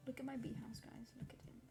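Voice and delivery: normal talking voice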